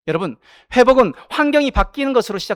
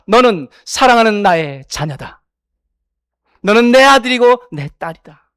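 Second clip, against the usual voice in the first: slow, louder, fatherly proclamation; God's tender voice; 2 semitones higher, 5 dB louder than usual. Acted. Preacher voicing God to believers, slow and louder. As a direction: speak as God claiming his children. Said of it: "그러나 하나님 우리에게 말씀하십니다".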